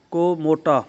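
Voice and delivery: usual speaking voice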